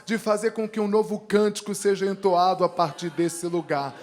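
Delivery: loud, emphatic declaration of blessing; Segenszuspruch. Real, not acted. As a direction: proclaim the blessing with force, peaking on the yes. Declares a blessing over the musicians.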